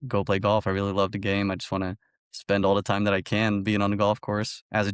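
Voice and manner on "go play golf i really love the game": ordinary delivery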